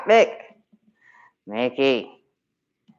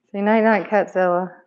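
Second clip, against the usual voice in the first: higher, louder sing-song to the cat; pet-talk voice; 5 semitones higher, 5 dB louder than usual. Real, not acted. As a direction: coo goodnight up high. Talking to cat at bedtime.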